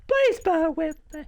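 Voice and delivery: very high put-on plea; silly high voice. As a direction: squeak the plea out for comic effect.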